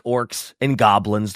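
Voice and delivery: ordinary delivery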